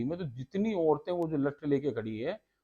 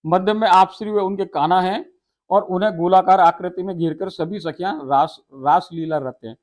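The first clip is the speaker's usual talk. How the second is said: raised, loud, solemn telling of the raas; devotional recitation; 2 semitones higher, 8 dB louder than usual. Acted. Preacher reciting the raas scene to devotees, loud and solemn. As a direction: recite the holy scene to the listeners.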